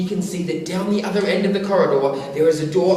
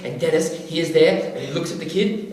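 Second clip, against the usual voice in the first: usual speaking voice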